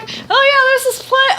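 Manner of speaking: high, eager imitation of Alicia; mock Alicia voice. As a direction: pipe it up like a chirpy party member. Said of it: "Alicia's like".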